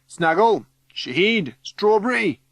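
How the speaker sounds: loud, high calls, name by name; calling out. A narrator's reading. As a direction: call friends out of a crowd. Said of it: "he calls".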